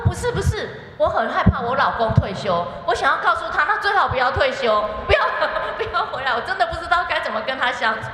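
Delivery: high, loud retold protest with laughing edges; mock frantic pleading. Church woman confessing dread of husband's retirement, mock frantic. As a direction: imitate a flustered wife, voice jumping up high.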